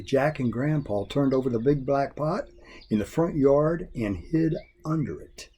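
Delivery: ordinary delivery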